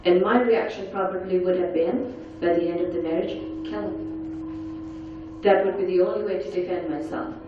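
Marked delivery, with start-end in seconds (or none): 3.7-5.4 soft unvoiced whisper; hushed dark whisper. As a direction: let it slip out under the breath. Dark confession of the urge.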